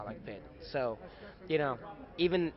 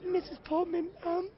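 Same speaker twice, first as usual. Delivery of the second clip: put-on much higher, sweet kid voice asking shyly; cute little boy. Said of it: "I go up this cute little boy".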